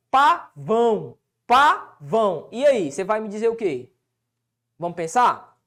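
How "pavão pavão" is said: each Pavão split slowly into PA, VÃO; syllable by syllable. Shown as a demonstration. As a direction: repeat Pavão in long, detached syllables. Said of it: "Qual que é aqui a sílaba tônica?".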